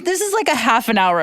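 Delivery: usual speaking voice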